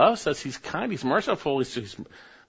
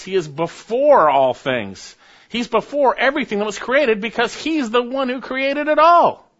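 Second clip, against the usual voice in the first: rising higher and louder to a shout on all; building fervor; 12 semitones higher, 8 dB louder than usual. Acted. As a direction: build up to a preaching climax. Preacher building to loud climax.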